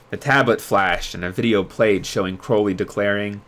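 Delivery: usual speaking voice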